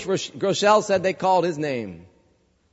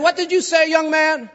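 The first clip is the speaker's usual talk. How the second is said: judge's question, pitched much higher; stern judge; 9 semitones higher than usual. Retold or imitated. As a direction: play the startled judge, higher. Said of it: "He said".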